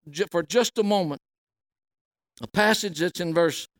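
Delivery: normal talking voice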